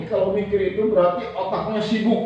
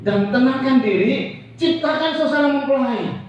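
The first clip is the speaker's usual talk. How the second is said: drawn-out, urging preacher's call; slow sermon exhortation; 5 semitones higher, 4 dB louder, slower than usual. Acted. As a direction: press each phrase slowly on the congregation. Preacher urges calm and love. (seruan khotbah yang lambat dan mendesak)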